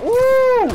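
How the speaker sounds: loud high yelp of surprise; sudden high gamer yelp; excited yelp. Real, not acted. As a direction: yelp as the hit lands. Hit in a game fight.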